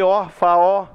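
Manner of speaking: "ó, fá, ó" com o O final aberto e destacado; demonstração de pronúncia. Shown as a demonstration. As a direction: pronuncie "fá, ó" em pedaços, com "ó" bem aberto. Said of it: "Você não tem som de O". (pronúncia separada, com ó aberto no fim)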